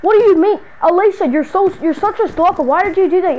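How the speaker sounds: loud, high accusing; annoyed yell at the stalker; exasperated scolding. Acted. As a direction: tell her off.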